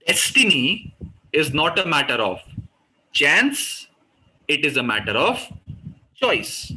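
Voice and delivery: slow, destiny chance and choice each weighted; stress on destiny, chance, choice. Shown as a demonstration. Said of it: "इस पे मैंने तीन जगह emphasis दिया, destiny, chance and choice". (destiny, chance, choice पर ज़ोर)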